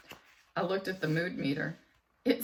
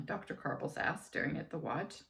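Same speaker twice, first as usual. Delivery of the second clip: ordinary delivery, nothing marked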